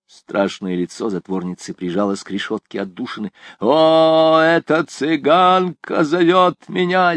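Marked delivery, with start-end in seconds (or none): 3.6-7.1 higher, louder, slow manic cry; wild laughing cry. A narrator's reading. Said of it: "с диким хохотом крикнула она".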